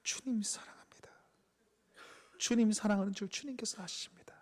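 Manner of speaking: barely audible unvoiced whisper; timid whisper. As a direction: confess it in a hushed, unsure whisper. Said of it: "자신 있게 말하지 못했을 겁니다". (자신 없이 속삭이는 고백)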